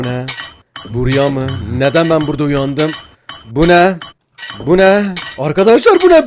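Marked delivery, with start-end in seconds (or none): 3.5-6.3 freaked out by what you see; real shock at a game scare; startled shout. Real, not acted.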